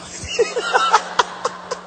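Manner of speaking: high, breath-filled chuckling; laughter. Real, not acted. Laughs at dropping the idea.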